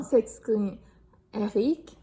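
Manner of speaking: lively storybook read-aloud; reading to children. A narrator's reading. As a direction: tell it brightly to young listeners. Reads picture book to children.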